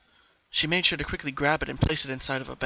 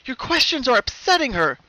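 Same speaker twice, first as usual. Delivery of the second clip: protective mother; 11 semitones higher, 10 dB louder than usual. A narrator's reading. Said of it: "her mother".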